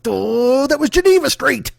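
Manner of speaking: high, loud dopey grumble; mock dopey Trump. Mocking Trump lost on the renamed street, dopey and annoyed. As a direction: play it dim and annoyed. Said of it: "And he's just like".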